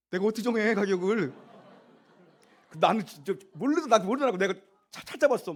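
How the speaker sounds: high, loud, flustered outburst; exasperated. Real, not acted. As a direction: throw up your hands, baffled. Exasperated over pricing the pottery.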